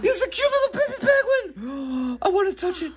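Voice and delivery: high squeal of delight. Real, not acted. Sees a cute baby penguin.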